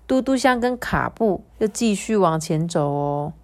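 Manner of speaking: usual speaking voice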